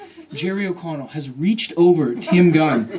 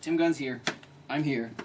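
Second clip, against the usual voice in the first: ordinary talk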